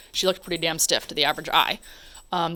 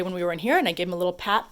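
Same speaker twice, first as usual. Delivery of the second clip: normal talking voice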